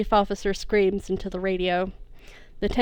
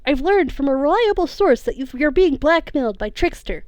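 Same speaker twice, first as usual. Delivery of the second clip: urgent; 7 semitones higher, 6 dB louder than usual. Acted. Hero urgently explains to villain mother.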